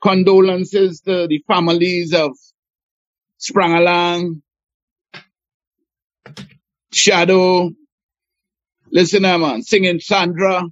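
slow, grave tribute to the dead; solemn tribute. Real, not acted. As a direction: honour the lost legends, slowly and loud. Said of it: "I have to say condolences".

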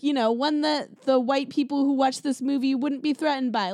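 ordinary talk

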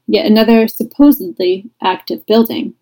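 ordinary talk, nothing marked

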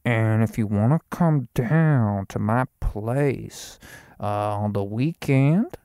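slow, put-on character voice; character bit. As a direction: slowly drawl out a made-up guy's pitch. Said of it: "something that goes like this".

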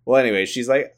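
usual speaking voice

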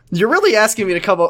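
normal talking voice

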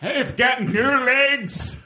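high, slow strained Scottish voice; comic high Scottish accent; mock Scottish. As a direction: drawl it in a squeaky fake Scottish accent. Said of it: "what is happening".

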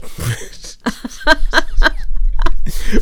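loud breathy laugh. Real, not acted. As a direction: burst out laughing at the awkwardness. Laughing at awkward kiss.

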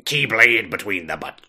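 pirate voice, trailing off; hammy pirate captain. Pretend. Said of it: "it's a pirate voice now".

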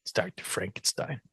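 conspiratorial whisper. Real, not acted. Joking aside to co-hosts, whispered. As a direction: let them in on the secret. Secret aside about the doctor.

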